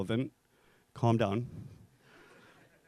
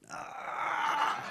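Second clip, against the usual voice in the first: high teasing gotcha sound; high mocking ah; 14 semitones higher, 4 dB quieter than usual. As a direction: tease with a squeak.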